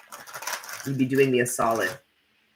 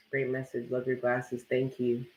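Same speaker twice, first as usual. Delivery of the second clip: usual speaking voice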